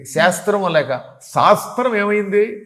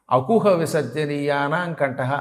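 slow, measured Sanskrit sutra chant; intoned like a Vedic teacher; sutra recitation; 3 semitones lower, 3 dB quieter, slower than usual. Shown as a demonstration. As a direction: recite the grammar rule deliberately. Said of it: "పాణినీయ వ్యాకరణంలో సూత్రం ఏమిటి?".

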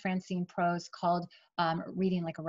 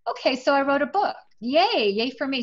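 higher and louder, tongue-in-cheek celebration; mock self-cheer; 6 semitones higher, 5 dB louder than usual. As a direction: cheer for yourself half-ironically. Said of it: "I was like".

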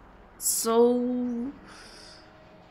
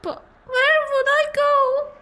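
very high, tearful granny wail; high voice breaking into acted sobs; crying old lady; 3 dB louder than usual. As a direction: sob it out as a despairing old woman. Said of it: "I shouldn't be crying like this".

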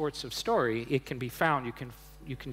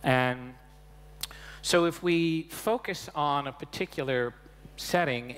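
normal talking voice

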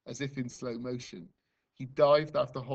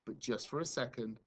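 usual speaking voice

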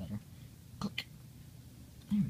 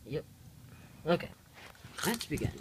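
usual speaking voice

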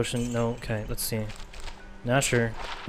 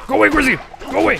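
much higher, louder yell; frustrated shout; 14 semitones higher, 9 dB louder, faster than usual. Real, not acted. Streamer yelling at the pet Grizzy to leave. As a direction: shoo him off at full volume.

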